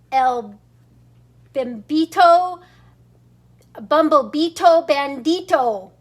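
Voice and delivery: plain reading voice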